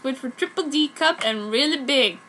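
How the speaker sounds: usual speaking voice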